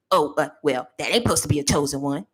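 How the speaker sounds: low, hushed mock-critic voice; mocking a judgmental critic. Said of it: "you'll say".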